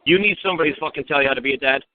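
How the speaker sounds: usual speaking voice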